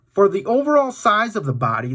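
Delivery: plain reading voice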